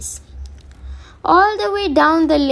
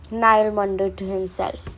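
ordinary delivery

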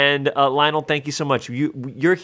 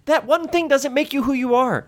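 exasperated pep talk; 12 semitones higher than usual. Acted. Heated lecture to friend.

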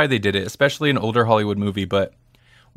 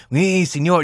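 caricatured Mexican accent, pitched higher; briefly exaggerated Mexican accent, higher voice; over-the-top mock Mexican accent; 5 semitones higher than usual. Shown as a demonstration. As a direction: ham up a fake Mexican accent. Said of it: "the kind of offensive racist accent".

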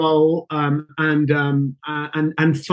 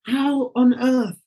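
higher and slow, stunned wonder; awestruck disbelief; 8 semitones higher, much slower than usual. Retold or imitated. Recalling crying in the studio president's office, stunned and slow. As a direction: marvel that this is happening. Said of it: "I think I was like".

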